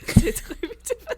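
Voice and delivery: giggling through a fake French accent; bad French accent. Shown as a demonstration. Kat redoing her bad French accent, laughing. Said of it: "I did a French accent for that".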